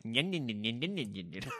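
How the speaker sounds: mock munching nyan sounds; panda nibbling noise. Comedian's bear-panda mauling a victim, mock nibbling sounds. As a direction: chomp away like a happy panda eating someone. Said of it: "it's just eating you like".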